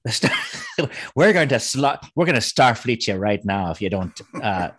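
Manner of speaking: Irish brogue sliding into pirate; hammed-up piratical Irish; mock Irish accent, pirate-like. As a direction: threaten like a stage Irish mobster. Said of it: "why do we sound like pirates".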